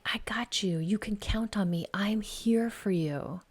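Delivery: soft, whispered reassurance; mother silently reassuring her little son in the crowd; tender whisper. Pretend. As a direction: whisper it like a promise to a scared child. Unspoken message to small son.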